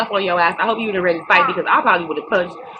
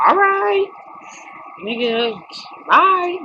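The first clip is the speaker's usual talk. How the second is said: dismissive bye; 12 semitones higher, much slower than usual. Retold or imitated. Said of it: "I would've been like".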